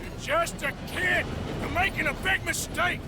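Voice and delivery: high, loud scolding cry; forceful shout. Acted. Arthur yelling at Jamie in the standoff, loud. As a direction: yell to shake sense into him. Arthur argues with armed kid.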